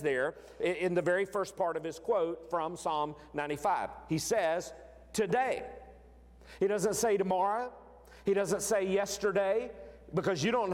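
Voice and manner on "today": TODAY set apart, pitched higher; emphatic TODAY. Retold or imitated. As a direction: land firmly on the word TODAY. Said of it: "that emphatic".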